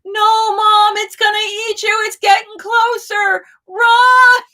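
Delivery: put-on panicked child's scream, very high and loud; frantic kid yelling at mom to run from the dinosaur; shrieking child. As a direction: shriek like a terrified little boy warning his mom. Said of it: "is shrieking".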